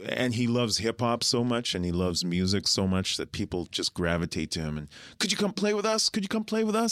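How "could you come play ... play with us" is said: begging fans. Retold or imitated. People begging him to play.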